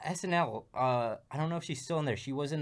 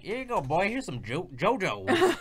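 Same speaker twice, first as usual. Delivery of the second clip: Southern dude; 6 semitones higher, 5 dB louder than usual. Acted. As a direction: play a hearty Southern chef. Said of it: "like, a real Southern dude".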